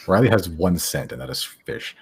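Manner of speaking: normal talking voice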